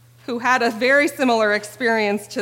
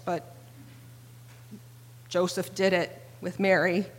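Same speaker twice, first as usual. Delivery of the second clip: ordinary talk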